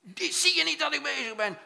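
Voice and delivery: put-on loud, high, annoyed father yelling at the child; irritated father. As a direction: bark at the child to go away. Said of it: "zei hij".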